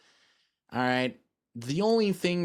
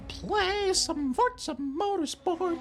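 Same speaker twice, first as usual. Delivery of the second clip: announcer voice; slower than usual. Acted. Announcer-style game intro.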